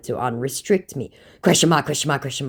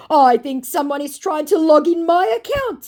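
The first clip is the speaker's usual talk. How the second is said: high, loud put-on protest; mock alarmed exclamation; 12 semitones higher, 6 dB louder than usual. Scammer making excuses to the host, mock alarm. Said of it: "Three more exclamation points".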